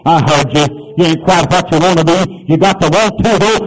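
ordinary delivery

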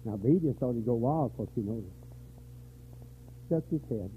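ordinary talk

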